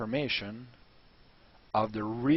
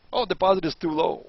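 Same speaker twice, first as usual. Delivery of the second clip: mock whiny vendor; 6 semitones higher, 6 dB louder than usual. Mocking the vendor's objection.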